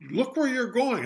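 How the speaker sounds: put-on prim old lady telling him off; cross old lady. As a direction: snap it like a startled granny. Old lady scolds bear in story.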